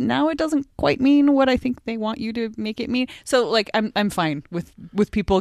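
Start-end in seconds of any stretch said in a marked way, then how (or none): 0.0-3.1 exasperated quote. Retold or imitated. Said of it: "It's like".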